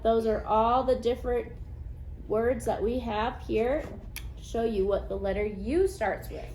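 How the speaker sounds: usual speaking voice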